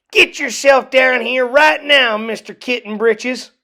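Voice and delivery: loud, high calling-down of a mother; stern order. A narrator's reading. Mother calling her son down, loud and commanding. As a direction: yell up the stairs at her kitten. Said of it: "ordered Mama June Bug Jones".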